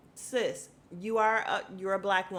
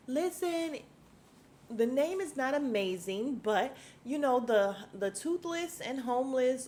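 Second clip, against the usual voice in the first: lightly voiced Kyle, pitched up and a bit louder; a hint of Kyle smoothing it over, raised voice; imitating Kyle; 6 semitones higher, 4 dB louder than usual. Recapping Kyle's fumbled charity name, high mock voice.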